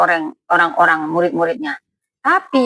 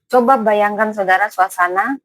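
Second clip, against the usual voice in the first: ordinary talk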